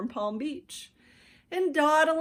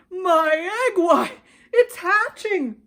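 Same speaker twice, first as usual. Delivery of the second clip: overjoyed cry; 9 semitones higher, 10 dB louder than usual. A narrator's reading. Horton amazed that his egg is hatching. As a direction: cry it out in wonder.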